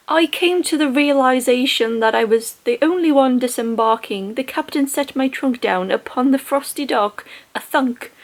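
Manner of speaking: amateur impersonation of a posh English audiobook narrator; attempted posh English accent. Shown as a demonstration. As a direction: read it out in a put-on posh British accent. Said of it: "it doesn't sound anything like that".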